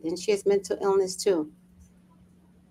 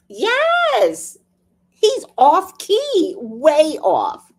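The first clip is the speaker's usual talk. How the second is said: much higher, loud and slow; high, drawn-out groan of agreement; high exaggerated agreement; 10 semitones higher, 5 dB louder, much slower than usual. Real, not acted. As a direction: stretch it out high in mock dismay. Agreeing boy sings badly.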